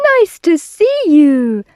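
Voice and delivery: high, loud welcoming hoot. A narrator's reading. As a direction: hoot a cheery greeting. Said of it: "and they hooted".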